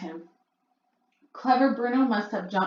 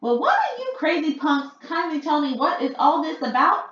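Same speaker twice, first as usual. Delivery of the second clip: higher, loud indignant shout; 9 semitones higher, 6 dB louder than usual. A narrator's reading. Bruno shouting at the crazy witches, indignant. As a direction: demand answers, fed up. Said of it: "shouted Bruno".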